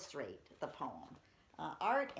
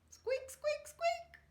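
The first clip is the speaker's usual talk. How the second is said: very high, tiny mouse squeaks; high-pitched squeaking mouse voice; squeaky mouse; 4 dB louder, much slower than usual. Retold or imitated. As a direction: squeak like a timid little mouse. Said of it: "he said".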